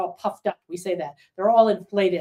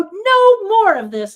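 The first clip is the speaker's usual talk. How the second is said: much higher, louder and slow; a slow, loud, determined no; firm anger; 12 semitones higher, 8 dB louder, slower than usual. Retold or imitated. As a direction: plant your feet and refuse, acted fury. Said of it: "you get angry".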